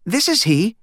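put-on high, proper little boy; a boy minding his phone manners; polite boy. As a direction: answer as a well-taught child. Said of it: "as his mother had taught him".

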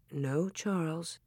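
very soft, slow gentle reproof; hushed, drawn-out protest; murmured. A narrator's reading. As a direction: murmur it slowly under the breath. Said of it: "Ma murmured".

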